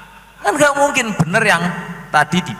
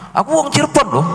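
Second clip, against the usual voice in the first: higher, boastful mock claim; mock claimant voice; 11 semitones higher, faster than usual. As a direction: play the man bragging he is from Cirebon. Imitating self-claimed Cirebon man. (meniru pengaku orang Cirebon dengan nada tinggi)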